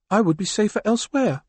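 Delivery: a cool woman planning her escape; Chrysalis. Acted.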